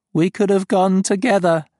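high, loud alien regret; sorrowful beast voice; wistful dying creature. Acted. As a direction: mourn what might have been. Dying farm speaks to Joe.

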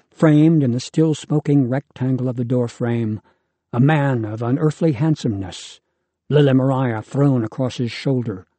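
usual speaking voice

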